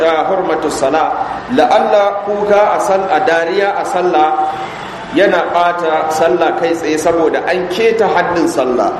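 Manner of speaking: ordinary talk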